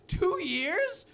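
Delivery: high, rising shocked question; disbelieving. Retold or imitated. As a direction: retell the disbelief. Retold disbelief at girlfriend.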